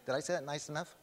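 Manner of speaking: normal talking voice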